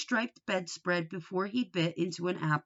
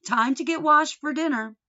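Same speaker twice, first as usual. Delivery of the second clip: louder and higher, calling through a wall; 7 semitones higher, 7 dB louder than usual. A narrator's reading. Mother calling son to dinner, loud and high. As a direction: call him in to dinner. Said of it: "his mother called from the next room".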